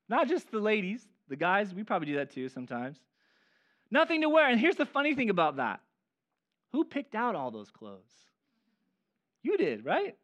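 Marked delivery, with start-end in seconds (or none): 3.9-4.5 1 mock complaint. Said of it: "you said".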